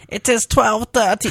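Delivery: high, slow Russian lady voice with a German tinge; mock Russian accent. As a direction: imitate a complaining Russian woman, high and loud. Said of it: "She's, like, a Russian lady".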